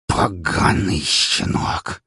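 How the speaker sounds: slow venomous hiss. A narrator's reading. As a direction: hiss it like an angry snake. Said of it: "прошипел Гаврилович".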